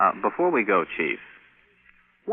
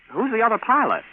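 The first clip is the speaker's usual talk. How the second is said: surprised; 4 dB louder than usual. Acted. Sudden question about co-pilot.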